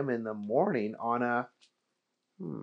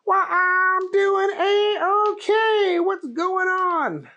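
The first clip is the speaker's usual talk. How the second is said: very high, slow drawn-out drawl; Keith voice; 6 dB louder, much slower than usual. Acted. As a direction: stretch every word like a lazy cowboy.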